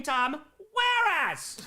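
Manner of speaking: "Whereas" drawn out slowly and stressed hard; emphasis on Whereas. Shown as a demonstration. Streamer reading Tom's new name to chat, stressing "Whereas". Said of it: "You gotta really put the emphasis on there".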